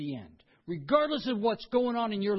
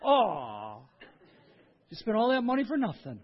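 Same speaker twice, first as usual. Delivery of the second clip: a laughing "aw" then quick teasing pity; playful fake pity, laughing; laughing sympathy; 3 semitones higher, 5 dB louder than usual. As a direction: chuckle through mock consolation. Mock sympathy for sick cruiser.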